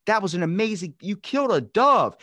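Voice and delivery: high-pitched mock astonishment, rising in force; gush, then cry out in disbelief; amazed. Imitating interviewers talking to the pitcher, amazed.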